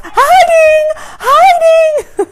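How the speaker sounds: put-on high, loud toddler shout. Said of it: "he shouts".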